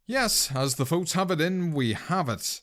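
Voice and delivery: big, high-pitched winner announcement; award announcer. Acted. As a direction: build up to the grand reveal of the winner. Announces award winner over music.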